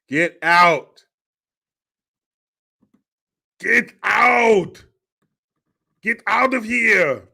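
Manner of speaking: loud, high yell, building; hammed-up holler, drawn out at the end; mock angry shouting. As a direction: yell it like chasing off an intruder. Mock ordering intruder out of driveway.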